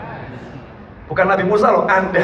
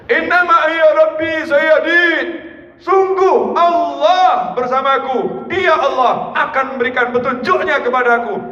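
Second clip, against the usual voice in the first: prophet's cry; 6 semitones higher, slower than usual. Acted. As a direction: declare faith slowly and firmly. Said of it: "Nabi Musa alaihissalam teriak". (Musa berseru sangat lambat penuh keyakinan)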